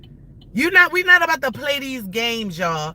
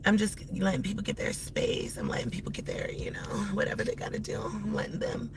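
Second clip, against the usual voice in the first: soft unvoiced whisper; whispered aside; 3 semitones lower, 11 dB quieter, faster than usual. Real, not acted. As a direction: hush it to the camera. Whispers calmly to camera.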